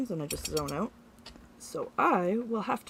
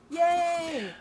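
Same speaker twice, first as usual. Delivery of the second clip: cheerful yay; 6 semitones higher than usual. Real, not acted. Cheering mailers arrived.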